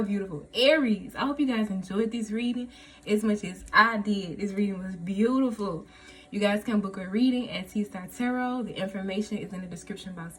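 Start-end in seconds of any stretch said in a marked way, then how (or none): none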